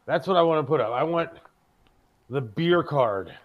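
usual speaking voice

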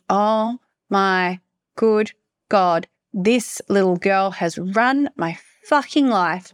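very slow, word-by-word disbelief; stunned realization. Retold or imitated. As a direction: let each word land slowly, as if dawning on you. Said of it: "I just sat there thinking".

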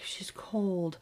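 hushed, voiceless whisper; whispered dread. Retold or imitated. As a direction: breathe it out in grim fear. Friend whispers over still dog.

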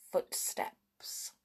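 breathy suspenseful whisper. A narrator's reading. Suspense before footsteps.

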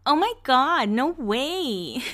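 put-on high, wavering baby-doll voice; exaggerated high impression; imitating Jessica. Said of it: "That's how she talks. She's like".

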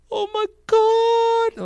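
very high, loud hammed-up wail; mock crying. Preacher parodying a pregnancy reaction video, mock crying. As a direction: imitate the weepy vlogger, drawn out. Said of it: "record like that girl".

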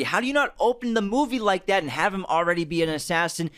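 usual speaking voice

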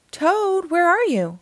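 Frog's loud call. A narrator's reading. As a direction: call high into the house for a friend. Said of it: "called Frog".

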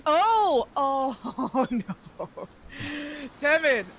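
high "oh" sinking into a laugh; dismayed realization. Acted. Captain learns kid's daddy is Timon, dismayed cry. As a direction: exclaim in mock horror, then call the name.

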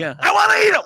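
hammed-up Kinison shriek building to a scream; Sam Kinison impression. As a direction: yell it like a comic raging from hell. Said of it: "as Sam Kinison".

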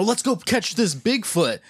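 high, slow excited voice; gung-ho hunter; eager character voice. Retold or imitated. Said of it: "I like how he's like".

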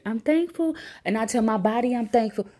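high, loud celebration; exuberant gratitude. Acted. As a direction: belt out thanks with delight. Exclaims favourite affirmation joyfully.

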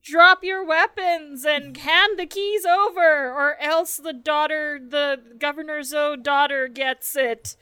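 slow, loud threatening growl; menacing threat. Acted. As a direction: demand it slowly and loudly. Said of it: "be like".

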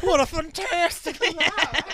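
high, laughing echo; laughing mock. Cohost echoes the statement, laughing high. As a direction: laugh through the line. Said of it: "repeats line laughing".

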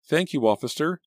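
high, relieved thanks; grateful. A narrator's reading. Teacher thanks the police officer with high relief. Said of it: "Mister Riordan said gratefully".

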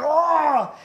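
high, loud mock cry of pain; imitated pained cry. Boy hit by his dad, acted loud yelp. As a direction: cry out as if just smacked.

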